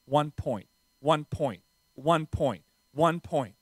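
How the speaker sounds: low, soft repeated mantra; self-talk. Retold or imitated. Player focusing himself mid-match, low quiet self-talk. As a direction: say it under your breath, each time evenly. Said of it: "I would say it out loud".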